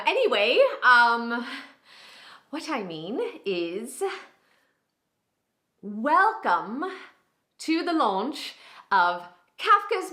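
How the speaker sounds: Bridget Jones. Acted. Publishing employee introduces a book as it goes downhill, flustered. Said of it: "it just starts, like, going downhill".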